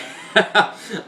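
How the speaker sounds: high, breathy laugh that escapes; real burst of laughter. Real, not acted. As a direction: laugh breaking through. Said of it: "I'm trying not to laugh".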